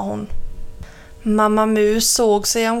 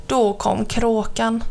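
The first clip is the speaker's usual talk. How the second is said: usual speaking voice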